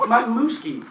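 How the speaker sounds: high, fond mimic of the lady; imitating the receptionist. Receptionist proudly naming her dog, mimicked in a high voice. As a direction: chirp her pet's name fondly. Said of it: "She said".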